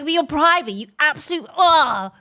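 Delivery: exasperated outburst. Acted. Sister fuming at private employers over wages, exasperated outburst. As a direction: rant until words fail into a groan.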